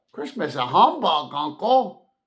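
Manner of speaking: can't believe his ears; amazed disbelief. Acted. Nephew startled by his uncle's scorn, amazed protest. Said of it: "said Scrooge's nephew".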